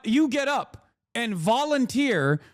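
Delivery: ordinary talk, nothing marked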